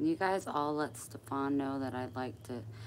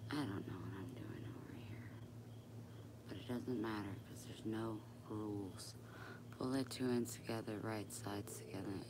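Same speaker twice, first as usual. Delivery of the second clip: breathy unvoiced whisper, much softer; whispering to herself; 4 semitones lower, 12 dB quieter than usual. Real, not acted. Sewer whispering to herself over her work. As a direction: whisper under your breath while you sew. Muttering to herself while sewing.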